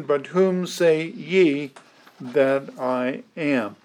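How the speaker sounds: slow, weighty reading of "But whom say ye that I am"; slow scripture quote. Shown as a demonstration. Preacher to congregation, slow and deliberate. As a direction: lay each word down slowly. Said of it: "Now you'll notice the ye".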